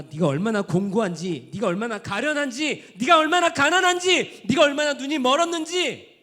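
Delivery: rising sermon cry; building shout. Acted. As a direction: preach it up to a crescendo. Rising preaching climax.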